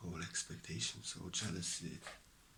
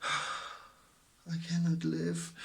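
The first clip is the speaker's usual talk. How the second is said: much higher, louder, very slow lament; imitating someone in despair; mock despair; 7 semitones higher, 4 dB louder, slower than usual. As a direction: ham up a despairing sigh. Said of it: "You may say".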